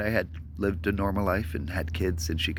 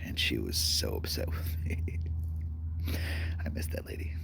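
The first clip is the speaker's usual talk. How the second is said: whispered grief; 11 semitones lower, 6 dB quieter than usual. Real, not acted. Grieving mother memory, whispered.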